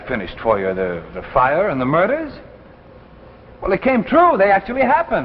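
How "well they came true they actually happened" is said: higher and louder, alarmed. Acted. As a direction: blurt out the frightening news. Telling Morton stories came true.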